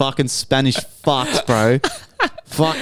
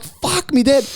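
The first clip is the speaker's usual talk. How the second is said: high exasperation; 12 semitones higher, slower than usual. Retold or imitated. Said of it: "I'm like".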